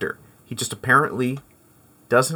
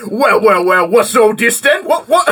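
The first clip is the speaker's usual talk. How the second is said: loud, higher, hammed-up London copper; mock Cockney bobby; 6 semitones higher, 5 dB louder than usual. Guessing the friend's bobby line, loud mock Cockney. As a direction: play the pompous bobby with a laugh. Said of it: "I thought for sure it was going to be".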